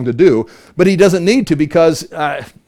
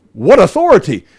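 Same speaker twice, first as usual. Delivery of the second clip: acted amazement; 5 semitones higher, 4 dB louder than usual. People's amazed response acted out.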